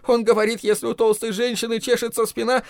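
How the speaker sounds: тонкий, высокий голос перепуганного гонца; выпали послание высоко, помимо своей воли; выталкивая страшные слова. A narrator's reading. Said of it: "выталкивая слова, которые он не решался произнести". (испуганно, тонким высоким голосом)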